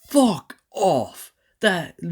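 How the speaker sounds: loud, high furious cry; furious outburst. Real, not acted. Fan yelling at Jeff Bezos over the series, furious shout. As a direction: spit it out in real rage.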